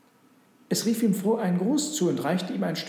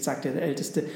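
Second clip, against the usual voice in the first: plain reading voice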